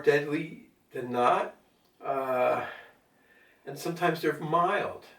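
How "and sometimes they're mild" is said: ordinary talk, nothing marked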